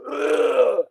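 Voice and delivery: put-on high, drunken ugh; drunken groan. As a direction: groan like a wasted buddy. Drunk character in read sketch.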